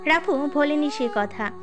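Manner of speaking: ordinary talk, nothing marked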